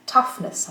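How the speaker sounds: "toughness" with a punched "tough", weak "ness"; stress on TOUGH. Shown as a demonstration. Teacher showing another neutral suffix, "toughness" stressed on "tough". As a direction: lean on the first syllable of "toughness". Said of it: "L'accent reste sur".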